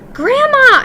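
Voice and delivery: loud, high desperate shout. A narrator's reading. Boy-mouse yelling to his grandmother to open the door. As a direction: yell as loud as you can through the door. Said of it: "I shouted, as loudly as I possibly could".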